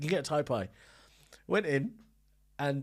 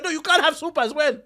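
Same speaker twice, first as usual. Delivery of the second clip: stern refusal; 12 semitones higher, 9 dB louder than usual. Retold or imitated. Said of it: "He goes".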